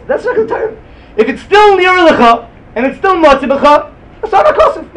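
animated high-pitched protest; emphatic rejection. Real, not acted. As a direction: knock the idea down with rising heat. Teacher rejecting a reading emphatically.

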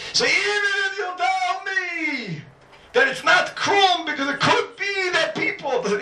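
very high, drawn-out cry of argument; impassioned insistence. Real, not acted. Teacher brushing aside an objection, impassioned and high-pitched. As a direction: stretch the words out slowly at the top of the voice.

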